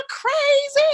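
very high, stretched yell; excited scream. Retold or imitated. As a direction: squeal the word out. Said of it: "screaming and just acting crazy".

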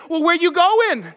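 high, challenging mock yell, drawn out; yelling mother. Mother confronting a passenger, yelling in imitation. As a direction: imitate an angry mom demanding an answer. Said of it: "she is yelling at me".

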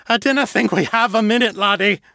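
gruff Scottish crewman, high and worried; Scottish accent. Acted. Aircaster answering Harold in a worried Scottish accent. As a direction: Scots brogue on a doubtful reply.

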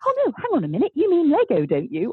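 high, lightly bubbly self-mockery; bubbly blonde. To the MD, mocking herself as a bubbly blonde. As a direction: play up your ditzy bubbly self. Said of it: "bubbly blonde who's going".

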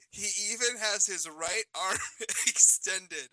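laughing through the words; reading while cracking up. Real, not acted.

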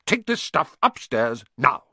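irritable, forceful command; snapped. A narrator's reading. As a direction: order him off, punching NOW. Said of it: "snapped his uncle".

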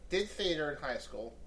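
usual speaking voice

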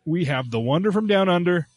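booming ring announcer intro; wrestling announcer. Acted. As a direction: hype up the fighter, ringside style. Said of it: "I wanna hear your wrestling voice".

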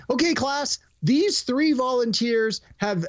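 put-on cop addressing children. Cop introduces volunteers to visiting children, high presenter voice.

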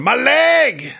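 high shrill cry of fake pain; mock injured wail. Retelling woman faking injury.

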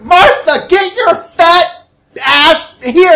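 high, slow, loud yell; shout. Acted. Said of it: "she's like".

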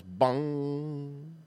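drawn-out gong ring, fading; imitated gong. Host telling of a gong strike, long resonant imitation. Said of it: "they hit one of those gongs and it goes".

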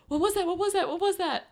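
high, loud startled questions, a touch frightened; replaying the moment the water burst in alarm; retold startled panic. Mother retelling her shock at her water breaking, high and alarmed. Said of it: "it scared the crap out of me".

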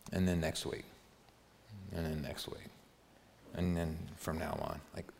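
gently pressing the congregation; soft searching questions. Real, not acted. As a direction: draw them in quietly. Pastor's softer, intimate challenge.

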